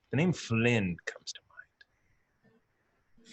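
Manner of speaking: whispered. Acted. Sword's voice answering in their heads.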